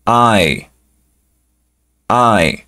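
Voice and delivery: "I" said like the English letter name; letter I alone. Shown as a demonstration. Teacher shows the letter I for "five". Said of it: "la pronunciación de la letra I cuando está sola".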